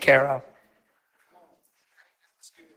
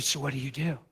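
much softer, leaning-in whisper; 2 semitones lower, 12 dB quieter, faster than usual. Retold or imitated. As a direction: drop to a hushed voice to get her attention. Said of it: "I got my voice to a whisper".

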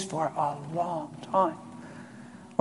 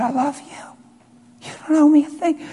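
imitated crying, voice pitched up; tearful quote; 10 semitones higher than usual. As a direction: repeat the loving words through tears. Tearful son quoting father's words.

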